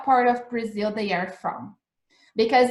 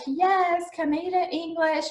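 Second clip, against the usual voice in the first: high-pitched retold enthusiasm; retold eagerness; 8 semitones higher than usual. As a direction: act out your old excitement in a higher voice. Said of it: "I was so like".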